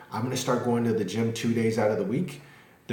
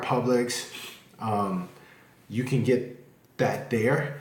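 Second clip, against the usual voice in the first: ordinary delivery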